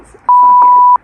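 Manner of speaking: high, loud character outburst; acted burst. Reader voicing the hero giving in, a high loud burst. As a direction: blurt it like the hero finally snapping. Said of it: "he just says".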